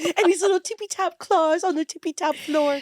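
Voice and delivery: cutesy voice for her dog. Pretend. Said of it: "everyone just saw my dog voice".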